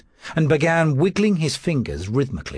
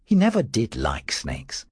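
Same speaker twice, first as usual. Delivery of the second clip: usual speaking voice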